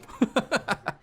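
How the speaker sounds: a short high giggle. Real, not acted. Giggling after telling Russia to eat them. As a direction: giggle at his own joke.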